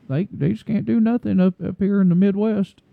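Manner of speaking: hammed-up Southern drawl mocking the SEC. Podcaster mocking SEC fans in a Southern accent. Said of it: "That was a little too Southern sounding".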